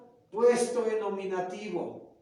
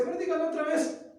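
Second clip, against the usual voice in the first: mock child; 9 semitones higher, faster than usual. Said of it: "Y dice".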